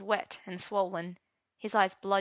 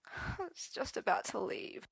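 very soft, whispery and ashamed; tearful murmur; 9 dB quieter than usual. A narrator's reading. Arthur, caught crying at the door, soft tearful murmur. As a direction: barely get it out through tears.

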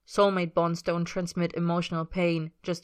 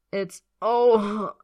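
loud pained gasp; pained groan; 3 semitones higher, 6 dB louder than usual. A narrator's reading. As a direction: groan through sharp pain. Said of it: "Roy groans".

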